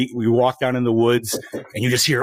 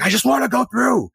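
high-pitched mock whine of an annoyed daughter; exasperated little-girl impression; mad child; 9 semitones higher than usual. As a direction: imitate a fed-up kid, pitched up. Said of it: "she got mad".

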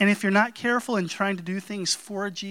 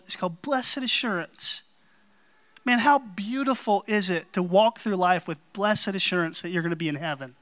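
normal talking voice

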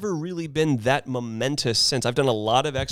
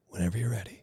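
soft; 5 semitones lower, 7 dB quieter, faster than usual. Retold or imitated. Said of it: "just kinda be like".

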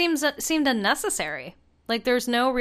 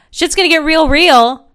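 hyped; 8 semitones higher, 10 dB louder than usual. Acted. Hyped topic announcement.

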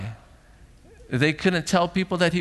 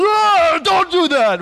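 much higher, much louder fake fury; mock overblown outburst; 7 dB louder, slower than usual. Shown as a demonstration. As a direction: overdo the scolding on purpose. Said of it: "I don't get like, intense".

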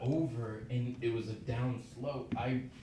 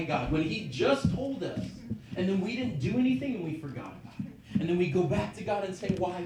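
rising, louder preacher's exhortation, higher; preaching; 8 semitones higher, 6 dB louder than usual. Acted. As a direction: drive it home to the church. Preaching to the congregation.

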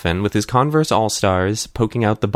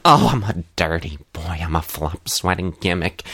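low, hammed-up character impression; Uncle Arthur; 4 semitones lower than usual. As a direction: put on a lower comic character voice. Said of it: "Uncle Arthur appears. He's like".

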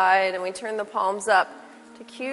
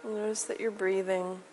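soft spa whisper; 5 semitones higher, 10 dB quieter than usual. Acted. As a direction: lull them into stillness softly. Guided relaxation in Shavasana.

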